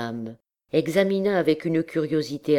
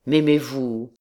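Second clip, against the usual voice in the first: man's question at usual pitch; captain's voice. A narrator's reading. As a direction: ask it squarely, without girlish lift. Captain turns the question back.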